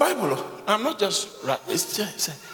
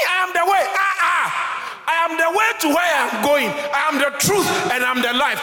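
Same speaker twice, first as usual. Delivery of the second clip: high, loud, fervent preacher shout; full-force preaching; 14 semitones higher, 7 dB louder than usual. Acted. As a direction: thunder it out to the congregation.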